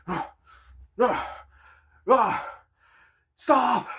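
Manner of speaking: high, strained mock pants while running; mock running grunts. Kids watch him run for the bus, mock panting. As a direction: imitate a fat kid gasping as he runs. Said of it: "watching my ass going".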